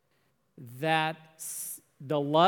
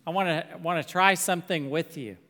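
ordinary delivery

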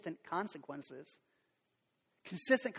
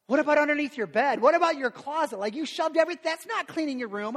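2 loud, high, exasperated scolding; 3 call out the mess like a fed-up dad; 8 semitones higher, 7 dB louder than usual. Retold or imitated. Dad scolding kids over messy room.

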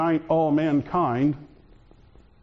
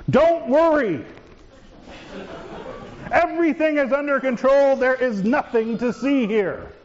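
nervous reassurance; 6 semitones higher than usual. Acted. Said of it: "Not always the most calming of statements".

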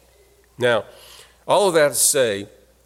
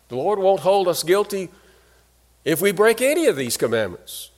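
questioning voice pitched higher; a listener's raised, puzzled objection; imagined objector; 6 semitones higher than usual. Retold or imitated. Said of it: "You might think".

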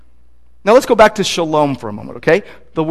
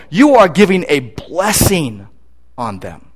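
usual speaking voice

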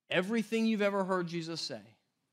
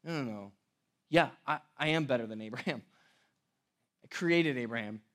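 paraphrased Jesus; 8 semitones lower, 5 dB quieter than usual. Retold or imitated. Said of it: "And then he says".

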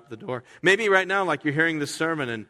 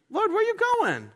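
a much higher, squeaky replay of the plain question. Acted. Peter's question redone mockingly.

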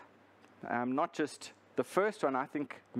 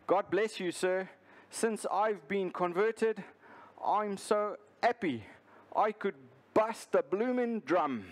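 higher-pitched Cockney drummer's voice; playful Cockney impression, pitched up and deliberate; Cockney accent; 4 semitones higher than usual. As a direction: answer as a happy Cockney drummer. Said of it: "And in his Cockney accent, the drummer replied".

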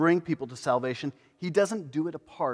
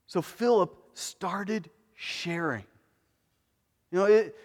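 ordinary delivery

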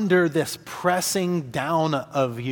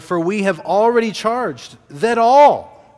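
higher, louder reading landing hard on "all"; emphatic ALL; 6 semitones higher, 7 dB louder than usual. Shown as a demonstration. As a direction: read it out, hammering "all". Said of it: "emphasized that word".

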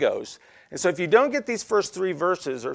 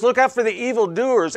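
"evildoers" with weight on the doers part; higher and louder, leaning into "evildoers"; stress on DOERS; 6 semitones higher, 5 dB louder than usual. Shown as a demonstration. Preacher quoting Paul's warning, punching the end of "evildoers". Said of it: "the emphasis on that word is doers".

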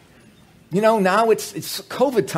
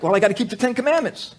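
smug believer; 7 semitones higher, faster than usual. Retold or imitated. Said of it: "so many people think".

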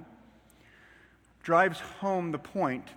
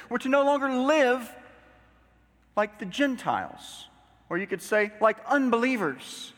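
higher, weighty proclamation with pauses; emphatic preaching; 9 semitones higher, 4 dB louder than usual. Acted. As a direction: hammer the main point from the pulpit. Preacher proclaiming the main point.

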